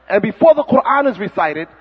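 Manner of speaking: ordinary delivery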